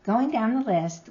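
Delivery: ordinary talk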